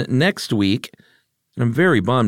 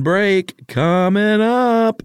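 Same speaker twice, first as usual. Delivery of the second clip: higher, slow drawn-out rock-singer line; 12 semitones higher, 3 dB louder, much slower than usual. Acted. Host answering give me a break, rock croon. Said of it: "that was my [name] ref".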